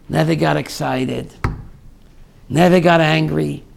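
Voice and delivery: ordinary talk, nothing marked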